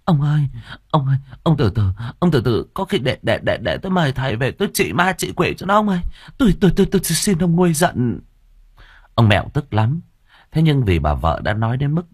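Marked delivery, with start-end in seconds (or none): 0.0-8.2 very high, fast stammering begging; desperate old woman clutching his leg; pleading wife. A narrator's reading. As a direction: beg the husband to calm down. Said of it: "mà van xin".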